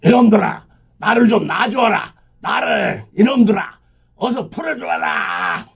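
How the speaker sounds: high desperate shouting; frantic yelling. Acted. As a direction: struggle and yell to be freed. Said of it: "발악을 했다".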